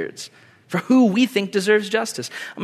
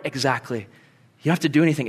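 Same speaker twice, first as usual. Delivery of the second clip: normal talking voice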